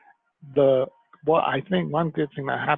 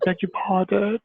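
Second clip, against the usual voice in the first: mock-polite puzzlement, pitched up; high, rising imitation of polite confusion; uncomprehending English locals; 7 semitones higher, 3 dB louder than usual. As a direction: mimic a baffled local asking for a repeat. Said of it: "constant, like".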